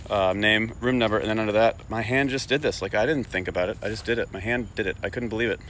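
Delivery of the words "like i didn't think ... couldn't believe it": plain reading voice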